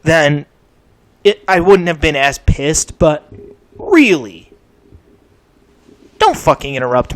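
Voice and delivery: loud insistent yell; shouting. Real, not acted. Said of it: "I couldn't contain myself".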